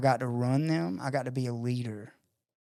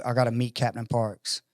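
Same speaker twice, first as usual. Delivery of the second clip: ordinary talk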